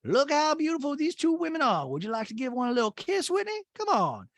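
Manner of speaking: exaggerated, very high coaxing mother voice; Patricia. Mother pushing Whitney toward the two women, high exaggerated coaxing. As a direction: nudge him suggestively in mock falsetto. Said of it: "she's kinda saying it to Whitney".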